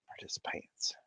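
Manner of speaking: hushed whisper. Acted. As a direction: whisper it softly. Whispering to the chat.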